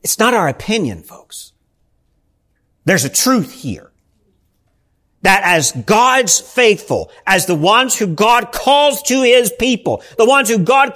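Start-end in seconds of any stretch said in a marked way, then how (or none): none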